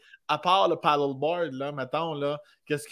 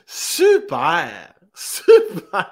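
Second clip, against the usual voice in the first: sarcastic; 9 semitones higher, 8 dB louder, much slower than usual. Real, not acted. Mock cheer at friend's mishap.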